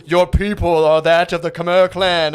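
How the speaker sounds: slow, loud, booming mock papa; Lion King voice. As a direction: boom it out like the sky in Lion King. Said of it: "the guy sounds like the sky from the Lion King".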